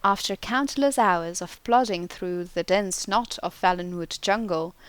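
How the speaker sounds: plain reading voice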